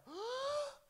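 a sudden high, loud intake of breath; sharp gasp. Retold or imitated. Girl in the back seat, shocked by the book, gasping. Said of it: "I remember her gasping".

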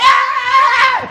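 high loud shout bursting in; mock battle yell. Entering the neighbour's house with a loud high war cry. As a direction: charge in yelling. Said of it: "Baixou o Capitão Nascimento".

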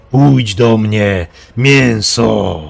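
very slow, loud beast voice; monster's rasp. A narrator's reading. Monster lures Mendeln into its claws, slow rasp. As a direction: draw it out, luring the prey. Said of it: "wychrypiał".

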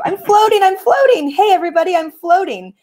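high, gleeful calling out; excited duck. A narrator's reading. Duck calling to everybody from the water, excited. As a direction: call out with singsong delight. Duck delightedly calls out while floating.

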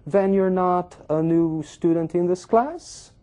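"Then you're not" high and slow, rising on "class"; doubtful intonation. Shown as a demonstration. Teacher demonstrating an informal question by intonation alone, doubtful. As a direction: stretch it out high, turning the statement into a doubtful question. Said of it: "um tom de dúvida que ela dá".

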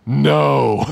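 loud, drawn-out demonic no; deep weird voice. Retold or imitated. Preacher imitates the troubled woman refusing prayer, deep weird voice. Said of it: "in a deep, weird voice".

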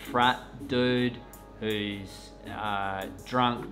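normal talking voice

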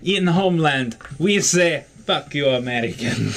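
mock Russian slipping toward Middle Eastern; hammy, wandering Russian accent; bad Russian accent. Player taunting Americans from the homeland, bad Russian accent. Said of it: "that one's kinda devolving into Middle Eastern".

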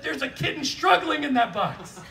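laughing wife quote. Retold or imitated. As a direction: ham up her alarm at the box, laughing. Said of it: "Katie looked at that box and said".

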